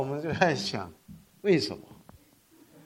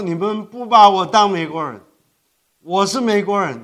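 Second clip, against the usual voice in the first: louder, pressing the complaint home; retold protest, voice raised and insistent; complaining; 5 semitones higher, 9 dB louder than usual. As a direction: stress on 把 in “你们不把我当美国人”, 把 hit hard and falling. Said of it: "我跟他们发牢骚，我说".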